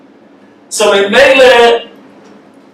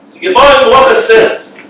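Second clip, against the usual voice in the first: normal talking voice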